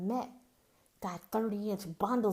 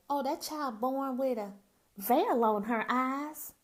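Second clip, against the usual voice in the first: put-on loud, higher Southern old-folks voice; Southern accent; 5 semitones higher, 8 dB louder, slower than usual. Old Mississippi folks remarking on a child, loud Southern accent. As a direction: exclaim it like Southern country elders. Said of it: "hear my southern accent come out".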